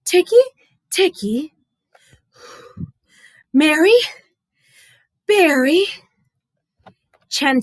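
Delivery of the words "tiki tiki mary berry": high broken-up panting words; gasping, halting. Acted. Exhausted boy telling the painter, halting and gasping. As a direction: gasp each word between breaths. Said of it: "he could barely speak".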